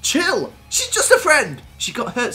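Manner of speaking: high, loud protest. Acted. Joel defending himself to Mackenzie, high loud protest. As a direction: protest hard, hammed up.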